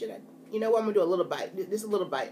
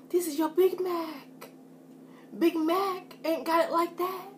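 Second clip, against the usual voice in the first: playful taunt; 6 semitones higher, 5 dB quieter than usual. Real, not acted. Taunting the Big Mac.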